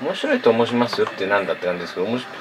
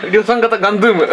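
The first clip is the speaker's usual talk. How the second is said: laughing; 10 semitones higher than usual. Pretend. Laughing at mass-produced Gandoom.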